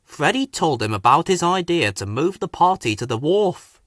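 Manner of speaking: plain reading voice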